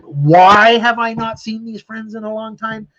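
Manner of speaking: high, loud, slowed rhetorical question; indignant outburst. Real, not acted. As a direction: stretch it out in real exasperation. Rhetorical outburst about absent friends.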